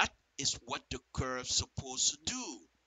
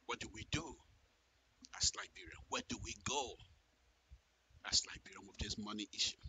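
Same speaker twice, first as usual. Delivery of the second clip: much softer, a grave hushed question; 5 semitones lower, 14 dB quieter, faster than usual. Real, not acted. Asking viewers about the money, hushed grave question. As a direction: ask it low and gravely.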